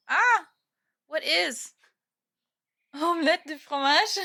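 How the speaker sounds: high, loud mock French; playful French accent breaking into a laugh. Streamer jokes back to chat in a mock French accent.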